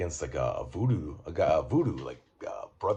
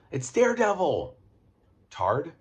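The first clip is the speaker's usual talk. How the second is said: exasperated yell; 11 semitones higher, 7 dB louder than usual. Acted. Mocking clueless Foggy.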